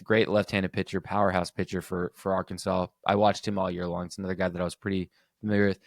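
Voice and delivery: usual speaking voice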